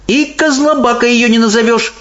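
put-on high girl voice losing patience; exasperated Sophie. A narrator's reading. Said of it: "всё больше выходя из себя".

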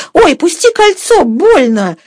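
sudden high, loud yelp of pain; crying out. A narrator's reading. Mistress's hand squeezed by Sergei, crying out. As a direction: cry out high and sharp in pain. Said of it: "вскрикнула Катерина Львовна".

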